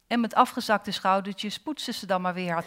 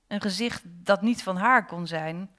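ordinary delivery, nothing marked

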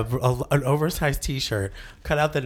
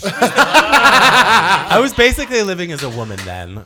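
laughing; 6 semitones higher, 7 dB louder, slower than usual. Real, not acted. Laughing while recalling past.

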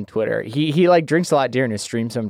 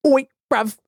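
high-pitched, deliberately awful British; really bad British accent; 13 semitones higher than usual. Shown as a demonstration. Showing off an intentionally terrible impression, hammed-up bad British accent. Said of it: "just a really bad one".

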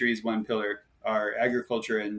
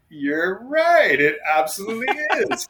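mock agreement; 9 semitones higher, 7 dB louder than usual. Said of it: "I'm like".

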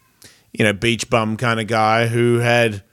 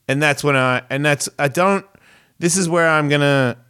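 ordinary delivery

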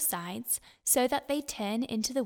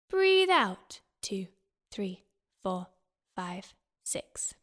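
slow counted breathing coach; 4 semitones lower, slower than usual. Shown as a demonstration. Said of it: "Let's have a go".

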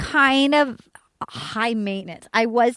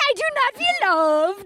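high, hammed-up French accent; French Canadian accent impression; 6 semitones higher than usual. Podcaster mimicking a contestant after a demo, French Canadian accent. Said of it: "your French Canadian sounded like".

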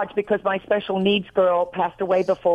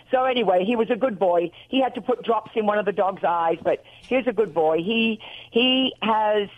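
normal talking voice

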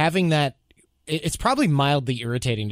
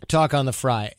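usual speaking voice

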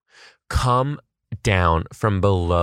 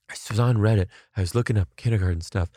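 usual speaking voice

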